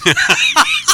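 high burst of laughter; big laugh. Real, not acted. Host cracking up at the pun, loud high laugh.

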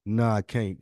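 ordinary delivery

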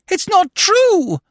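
very high, drawn-out scream of denial. A narrator's reading. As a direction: scream it in shrieking disbelief. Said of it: "she screamed".

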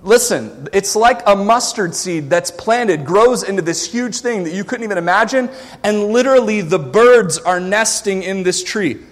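usual speaking voice

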